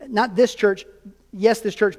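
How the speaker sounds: ordinary talk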